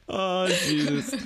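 ordinary talk

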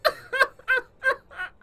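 laughing through tears; half laugh, half cry; teary laugh. Real, not acted.

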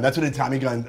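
ordinary talk, nothing marked

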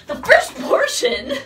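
high, loud laughing burst; laughing excitement. Real, not acted. Praising her own first take, high and laughing. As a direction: squeal it through a laugh.

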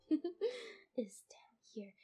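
soft breathy whisper. Real, not acted. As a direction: hush it as a secret. Whispered aside.

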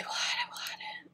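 quick whisper to herself. Real, not acted. Caving on the purchase, a quick whisper to herself. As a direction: hurry it out in a whisper, giving in. Deciding to buy, talking to herself.

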